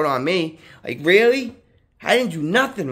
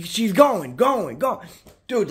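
ordinary delivery

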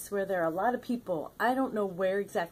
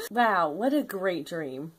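ordinary talk

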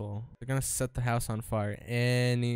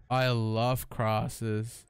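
slow mock drawl; 5 semitones lower, much slower than usual. Echoing the host's line.

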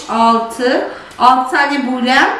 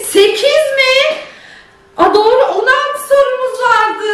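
surprised; 11 semitones higher, slower than usual. Real, not acted. Surprised at tied score.

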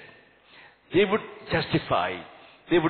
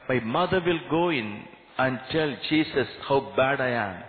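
usual speaking voice

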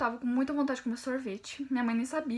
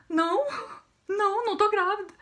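mildly mock panicked denial, higher and louder; 8 semitones higher, 4 dB louder than usual. Girl answering her cousin's question on the phone, panicked denial. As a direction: deny it emphatically, raised pitch and volume.

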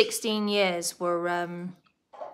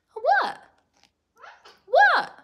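very high squeaky calls; pet-directed questioning; 4 dB louder than usual. Real, not acted. Owner answers squawking pet bird, high squeaky questioning.

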